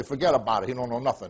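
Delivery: put-on low, dismissive New York Italian accent; New York Italian neighborhood talk. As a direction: imitate Italian New York brothers brushing someone off. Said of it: "the Italian neighborhood that I grew up in New York".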